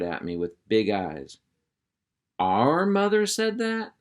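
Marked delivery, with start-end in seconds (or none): 2.4-3.9 put-on high, slow, wide-eyed boy voice; a puppy who can't believe his ears; astonished little boy. Roscoe amazed his mother said that, high wide-eyed boy.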